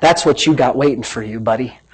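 low, quiet mock-friendly threat; taunting the devil. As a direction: let it sink low and soft on the last word. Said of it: "I tell him".

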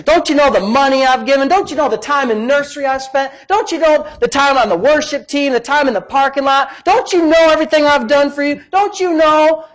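high, indignant mock tirade; hammed-up, aggrieved protest; huffy puffy. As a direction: list your sacrifices as an offended complainer. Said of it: "got all huffy puffy and said".

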